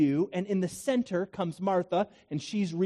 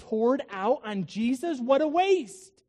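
acted outrage at money thrown away; Judas's dismay; 3 semitones higher than usual. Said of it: "that's how Judas felt".

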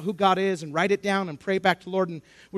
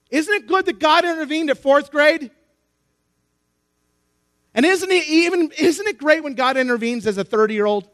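raised, loud rhetorical questions; impassioned preaching; 8 semitones higher, 7 dB louder than usual. Acted. Preacher rejoicing that God intervenes, impassioned. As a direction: press the congregation for agreement.

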